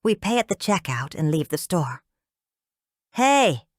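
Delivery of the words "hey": sudden loud call across a parking lot; yell. A narrator's reading. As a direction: shout to get their attention. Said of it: "someone yells".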